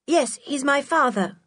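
put-on high young character voice; child's voice. A narrator's reading. George telling Mister Barling about her father, high-voiced. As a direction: answer brightly in a high child's voice.

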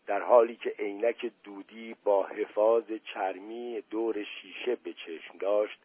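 plain reading voice

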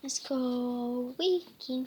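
ordinary delivery, nothing marked

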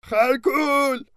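slow, drawn-out called greeting in another voice; calling a name. Acted. The centaur coming out of his cave, calling back slowly. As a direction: stretch the name out in joy. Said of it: "داد زد".

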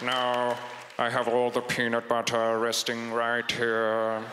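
low, slow drawl; low, drawn-out professor voice; Snape. Acted. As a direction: settle slowly into a low villainous British voice. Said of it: "I gotta find Snape's voice".

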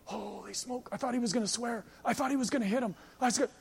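soft, hushed mock astonishment; hushed amazement. Young guys stunned at the boss's restraint, hushed amazement. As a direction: marvel under your breath. Young workers' awed aside, retold.

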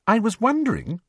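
lightly voiced young girl; Hermione voice. A narrator's reading.

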